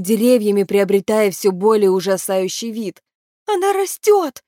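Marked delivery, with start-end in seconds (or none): 3.5-4.4 high-pitched scream of alarm; alarmed cry. A narrator's reading. Maeve watching the banshee grow, panicked cry. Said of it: "выкрикнула Мэв".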